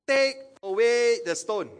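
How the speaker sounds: slow, high, loud order; commanding order. Acted. Jesus ordering the tomb opened, loud measured command. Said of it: "Jesus ordered the stone".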